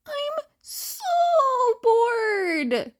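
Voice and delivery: a hammed-up whiny sob in a high boyish voice; mock whining. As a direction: whine it like a bored kid. Group chat message, sobbing emoji.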